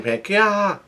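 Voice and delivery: high, mock "kya" squeal; imitated girl's yelp. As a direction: squeal lightly like a startled manga girl. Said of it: "she yells at him".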